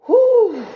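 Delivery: high, loud puff of relief; whooped exhale after a good cry; overcome whew. Real, not acted. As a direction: blow out a big emotional "whew". Overcome after recalling her crying.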